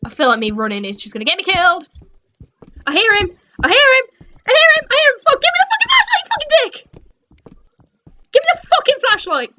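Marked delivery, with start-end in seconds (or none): 2.9-5.2 rising panic. Real, not acted. Panicked horror game repetition. 5.3-9.5 high frantic yell at the screen; high-pitched panicked demand. Real, not acted. Panicked yelling at game.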